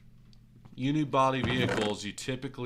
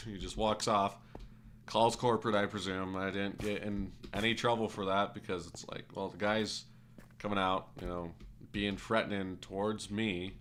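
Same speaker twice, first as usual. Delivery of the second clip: ordinary delivery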